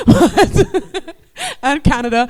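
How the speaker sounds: laughing. Real, not acted. Host apologizing for terrible French accent, laughing. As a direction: break into laughter mid-apology, slowed by it.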